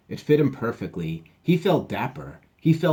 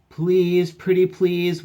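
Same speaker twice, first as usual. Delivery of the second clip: high, louder pleading; begging; 9 semitones higher, 7 dB louder, slower than usual. Acted. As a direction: beg sweetly, pretty please. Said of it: "used her best begging skills".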